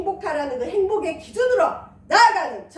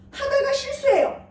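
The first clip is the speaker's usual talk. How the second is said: much higher, lifted and cheering; bright encouragement; 11 semitones higher, 5 dB louder than usual. Acted. Pastor cheering the congregation on, high and excited. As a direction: cheer them on to try again.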